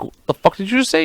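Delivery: high-pitched mock disbelief; retold incredulous challenge. Partner re-enacts hearing a mean remark, mock incredulous.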